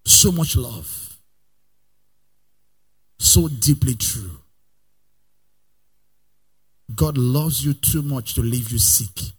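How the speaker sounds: soft, slow and low; tender and slow. Acted. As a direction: linger on each word with love.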